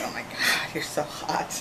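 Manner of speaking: hushed, voiceless fan gushing; retold starstruck thought under the breath; awestruck whisper. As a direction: whisper it as if secretly swooning. Retold awestruck thought while staring.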